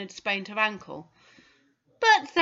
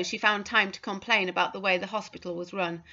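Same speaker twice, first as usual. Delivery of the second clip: usual speaking voice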